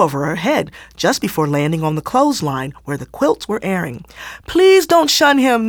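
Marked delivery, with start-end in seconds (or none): 4.5-5.7 high, louder, slow tearful plea; pleading cry. A narrator's reading. Naomi pleads tearfully with the elders to spare Fancy. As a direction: beg the elders through tears. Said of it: "Naomi cried".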